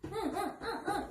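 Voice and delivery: high, soft wordless demanding grunts; short repeated whines in place of words; imitated toddler grunts. Consultant mimicking child asking without words, high little grunts. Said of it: "이렇게 하면 주잖아?".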